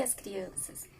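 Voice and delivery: sudden soft whisper; breathy whispered aside. Real, not acted. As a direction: drop the voice to a whisper. Explaining, drops to a whisper.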